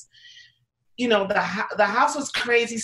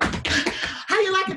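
eager asking; 9 semitones higher, slower than usual. Retold or imitated. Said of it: "like, sweating and".

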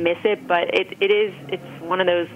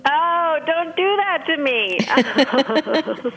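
drawn-out, slow, higher playful protest; mock anguish; 8 semitones higher, much slower than usual. Guest teasing the host over a hard pick, mock anguished and slow. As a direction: stretch it out like a hard choice hurts.

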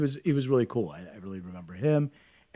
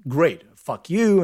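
loud, high mock shout at the teacher; defiant yell; 7 semitones higher, 9 dB louder, much slower than usual. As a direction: spit it out defiantly. Kid cursing at the teacher.

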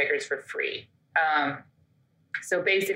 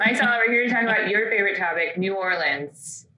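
mock announcer.

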